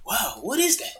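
lightly mimicked Australian amazement; Australian accent. Retelling his friend's surprise at plantain, imitated Australian accent. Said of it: "Is that your Australian accent?".

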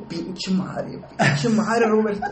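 high, slowing, half-laughing dad cursing; mock the father's relieved cursing; exasperated dad. Father cursing his son on the phone, exasperated.

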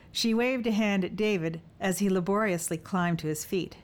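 ordinary delivery, nothing marked

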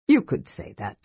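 low dragon voice with a faint smile; dragon with a hint of a smile. A narrator's reading. Dragon answers the kender, low with a faint smile. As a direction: let a small smile into the low voice. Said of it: "with the hint of a smile".

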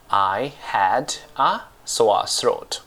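"I had a sore throat" very slow, words set apart; slow word-by-word model. Shown as a demonstration. Teacher shows learners the past tense, slow and separated "I had a sore throat".

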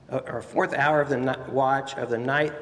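usual speaking voice

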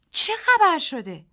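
high, loud, slow and scared; frightened question. A narrator's reading. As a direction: ask in panic. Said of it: "دیزی هراسان پرسید". (با هراس و صدای بلند)

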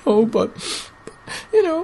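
put-on high, slow whining kid; sulky kid. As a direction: whine slowly like a kid. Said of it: "he's like".

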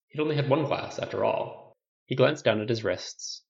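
ordinary delivery

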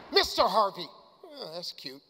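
normal talking voice

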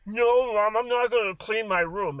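mock angsty teen yelling at mom; petulant teen yell. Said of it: "he kinda yelled".

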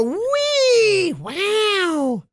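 squeaky high toy squeal; giggly doll voice. Retold or imitated. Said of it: "make her go".

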